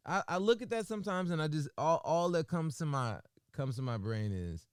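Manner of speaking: normal talking voice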